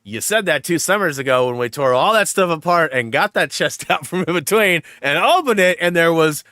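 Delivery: laughing exasperated retort. Retold or imitated. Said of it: "I go".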